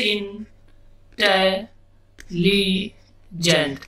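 इंटेलिजेंट very slowly as in ... te ... li ... gent; long pause after each of the four syllables of इंटेलिजेंट. Shown as a demonstration. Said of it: "चार syllable से मिलकर बना है". (इंटेलिजेंट के चारों syllable रुक-रुक कर)

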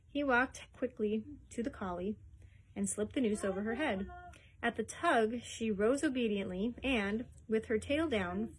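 usual speaking voice

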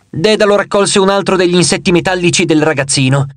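ordinary delivery

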